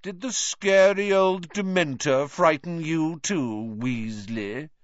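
high, loud, slow mockery; taunting Malfoy. A narrator's reading. Malfoy mocking Weasley over the scary Dementor, loud taunt. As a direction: draw it out to jeer at him. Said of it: "said Malfoy loudly".

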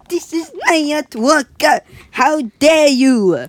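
high, loud, indignant artist voice; hammed-up outrage, how dare you; mock indignant shout. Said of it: "They're using their outside voices".

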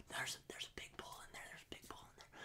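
hushed whisper while stalking. Retold or imitated. Hunting buddy whispering about a big bull. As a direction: breathe it out so the elk won't hear.

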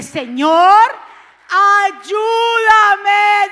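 preacher leading the people to cry out; crying out to God. Shown as a demonstration. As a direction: cry out ayúdame with everything. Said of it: "comienza a clamar a Dios y dile".

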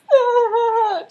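high, loud fake wailing; hammed-up bawling; mock crying. Lily crying into Sirius's shoulder, mock crying. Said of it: "just crying into his shoulder".

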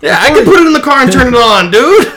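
loud, high, rapid-fire outburst; indignant retort. Retold or imitated. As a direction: shout it back fast, outraged at the fee. Indignant retort at locksmith's testing fee.

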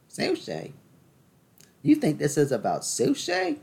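sudden high, put-on voice; mock outraged retort. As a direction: pretend someone scoffs at the sushi detour. Mock indignant other voice.

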